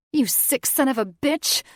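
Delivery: high furious scream at him. Acted. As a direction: scream it in rage. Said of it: "she screamed".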